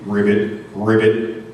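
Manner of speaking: low froggy ribbit; frog croak. Acted. Unseen caged frog heard by the escapees, low croak. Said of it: "The voice said it again".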